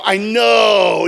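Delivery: loud, long-drawn commiseration; exclaimed agreement. Retold or imitated. Buyer to the shocked seller, loud drawn-out agreement. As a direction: stretch it out loudly in shared mock disbelief.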